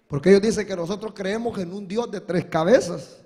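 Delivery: normal talking voice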